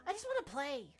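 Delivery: ordinary talk, nothing marked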